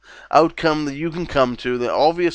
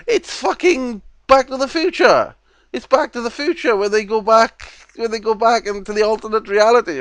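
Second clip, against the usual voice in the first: high, loud excited outburst; 9 semitones higher, 4 dB louder than usual. Real, not acted. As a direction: blurt it out all fired up. Excited rant about the film.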